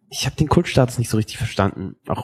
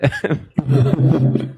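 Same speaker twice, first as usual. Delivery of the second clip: laughing. Real, not acted. Podcast host laughing at a colleague's remark, laughing. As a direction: burst of chuckling. Laughs after joke about wobbling.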